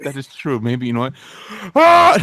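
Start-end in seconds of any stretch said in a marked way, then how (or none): 1.8-2.2 high shriek breaking into laughter; fake scream into laughter. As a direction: scream then crack up. Mock murder scream then laughing.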